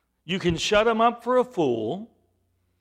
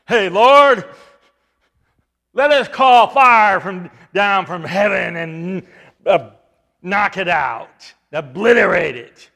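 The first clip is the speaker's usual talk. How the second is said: eager loud demand; 9 dB louder than usual. Retold or imitated. Apostles asking to call fire.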